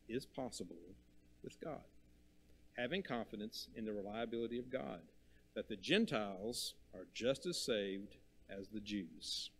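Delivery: ordinary delivery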